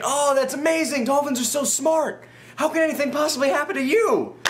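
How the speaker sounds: high, eager tourist gushing over dolphins; gushing excitement. Retold or imitated. Mocking his past self, gushing over the dolphins. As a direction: gush like a starstruck visitor. Naive delight at dolphin cove.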